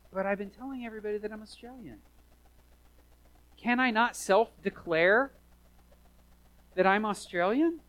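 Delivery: high-pitched, put-on pleading; mock indignant protest. Preacher acting out pleading to stay in Australia, mock indignant. Acted plea to Australian border officials.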